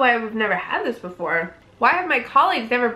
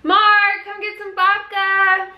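calling out; 9 semitones higher, 4 dB louder, slower than usual. Real, not acted. Calling Mark over for food.

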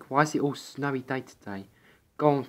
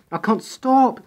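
panicked shriek; 11 semitones higher, 8 dB louder than usual. Acted. Sliding out of control in snow.

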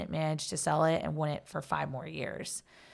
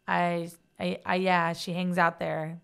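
ordinary talk

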